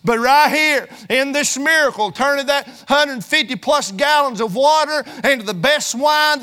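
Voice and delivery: loud, driving preacher's cadence; fiery preaching. Acted. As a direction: preach it hard to the congregation. Preaching at full force.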